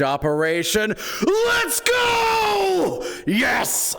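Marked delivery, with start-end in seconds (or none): 1.2-4.0 excited shout. Real, not acted. Excited shout as his crazy plan comes together. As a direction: yell it out like a win.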